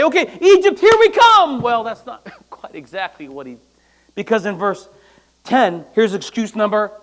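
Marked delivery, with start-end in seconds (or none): 0.0-1.6 mock eager Moses. Moses mock eager, preacher acting.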